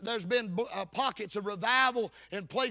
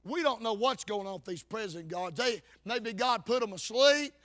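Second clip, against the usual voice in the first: ordinary talk, nothing marked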